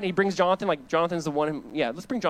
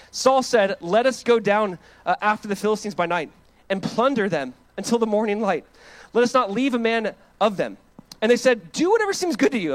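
raised, ringing preaching voice; loud scripture reading; 5 semitones higher, 6 dB louder than usual. Acted. Pastor reads Saul's story aloud to church, loud and ringing. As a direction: proclaim the verses to the congregation.

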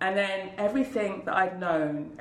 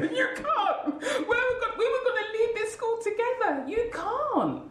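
high, crying plea; begging her not to leave, voice breaking high; pleading. Retold or imitated. Deputy begging her head teacher to stay, high tearful plea.

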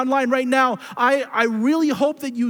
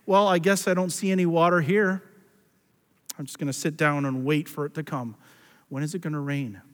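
mock giving up; 6 semitones lower, 4 dB quieter, faster than usual. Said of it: "You don't walk a few steps and say".

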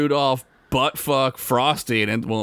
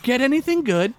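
higher mock listener voice, lightly hammed; Rich Masters voice; 11 semitones higher, faster than usual. Host still voicing the listener's email. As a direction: keep the put-on orphan tone. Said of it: "my best Rich Masters voice".

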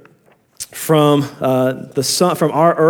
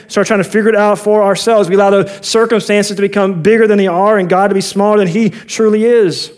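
ordinary talk, nothing marked

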